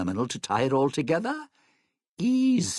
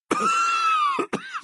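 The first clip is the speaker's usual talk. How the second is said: high, strained coughing; loud hacking cough from foul air; coughing fit; 4 dB louder than usual. Acted. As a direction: cough hard, choking on fog. Choking through the toxic fog.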